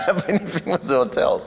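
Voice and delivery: amused at your own mix-up; laughing. Real, not acted. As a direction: laugh through the end of the sentence. Laughing at his hotel mix-up.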